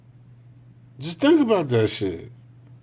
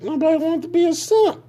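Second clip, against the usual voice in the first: mocking squeal; faster than usual. Real, not acted. Mocking high voice on simp.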